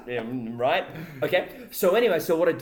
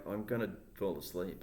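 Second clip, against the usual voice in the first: sleepy mutter; 6 semitones lower, 10 dB quieter than usual. Retold or imitated. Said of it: "I'm just thinking".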